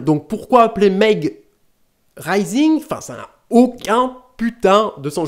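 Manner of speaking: high-pitched, exasperated outburst. Real, not acted. Vlogger ranting about a nonsensical film title, exasperated. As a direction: let the confusion boil over, pitch up.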